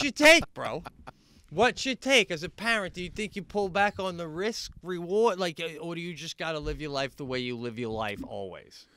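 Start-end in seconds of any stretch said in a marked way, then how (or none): none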